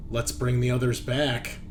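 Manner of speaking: ordinary talk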